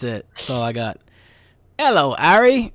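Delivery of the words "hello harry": lightly voiced Harry Potter English; a hint of a British wizard greeting; mock English accent greeting. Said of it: "I can only say Harry Potter phrases in English".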